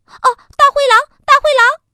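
high, loud frightened cry; scared scream. A narrator's reading. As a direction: shriek in terror at the wolf. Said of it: "害怕地叫起来了". (小兔子吓得高声尖叫)

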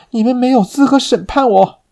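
high, loud shrill protest; desperate defiance. Acted.

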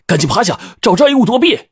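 high-pitched, hurried call to take cover; urgent warning call. A narrator's reading. Narrator warning the captain of the suction, urgent high call. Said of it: "我赶忙招呼邱队".

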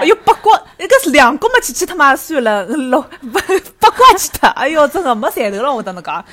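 loud, high auntie laughing while she bargains; market auntie voice. Pretend. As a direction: talk the customer round like a stall auntie. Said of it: "假装阿姨".

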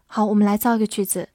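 ordinary delivery

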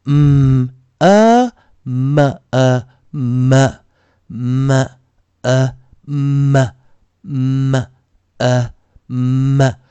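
嗯 hummed, 呃 as "uh", joined into 么 "mmm-uh"; slow separated drill, 么 released into a schwa; M plus schwa blend. Shown as a demonstration. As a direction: sound out 嗯, 呃, then blend to 么. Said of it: "但是我们念出一个schwa".

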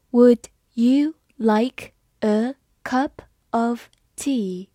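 slow, word by word; slow reading. Shown as a demonstration. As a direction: read it slowly for learners. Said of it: "好，我们慢慢来读". (慢慢地读)

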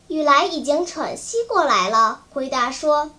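usual speaking voice

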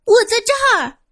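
high strained full-force shout; shouting with all might. Acted. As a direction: yell as hard as possible. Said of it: "用尽了最大的力气喊着". (用尽全力大喊)

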